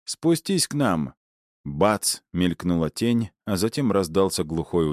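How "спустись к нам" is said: raised voice. A narrator's reading. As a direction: call up to him, voice raised. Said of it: "немного повысил голос".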